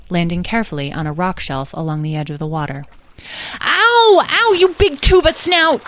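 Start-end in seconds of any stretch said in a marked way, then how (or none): 3.6-5.8 put-on high, loud squabbling child; yelled. A narrator's reading. Edwin yells at his brother Lyle, yelled. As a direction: yelp in pain and hurl an insult. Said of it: "yelled Edwin at Lyle".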